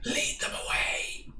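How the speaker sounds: breathy unvoiced whisper, slowed; stage whisper. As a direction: hiss it across the water. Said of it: "Stage whisper across the water".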